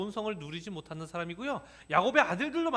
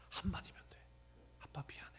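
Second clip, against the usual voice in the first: whisper; 17 dB quieter than usual. Retold or imitated. Inner voice pleading quietly.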